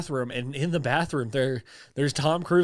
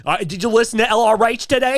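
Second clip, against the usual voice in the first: Brooklyn mook; 8 semitones higher, 6 dB louder than usual. Acted. Mocking the Brooklyn dad.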